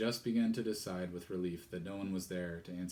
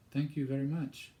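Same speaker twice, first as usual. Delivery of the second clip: high, nervous thanks; nervous young girl; 6 semitones higher than usual. Acted. Girl nervously thanks the strange vicar. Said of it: "Jane said nervously".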